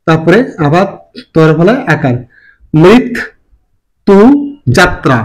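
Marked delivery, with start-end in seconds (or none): 2.7-5.2 very slow model word. Shown as a demonstration. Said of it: "teacher models slowly".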